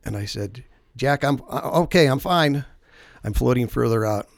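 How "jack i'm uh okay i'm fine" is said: raised, higher voice insisting; mildly shouted reassurance; retold call back. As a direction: call back that all is well. Shouting back to friend in story.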